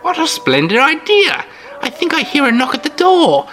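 put-on high, chirpy old-lady voice; sweet old lady. Old hostess brags about her jam, sweet high voice. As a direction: play the sugary granny host, all charm. Mrs Anders voiced in story.

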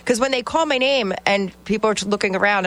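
usual speaking voice

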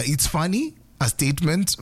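ordinary talk, nothing marked

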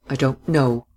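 ordinary delivery, nothing marked

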